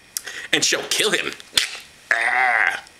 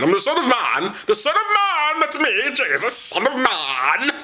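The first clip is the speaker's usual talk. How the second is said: much higher, slow and self-important; mock Jesus; 8 semitones higher, slower than usual. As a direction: preen about the title in a high voice. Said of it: "he likes to refer to himself".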